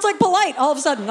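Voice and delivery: normal talking voice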